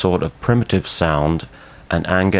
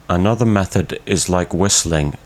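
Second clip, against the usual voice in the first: ordinary delivery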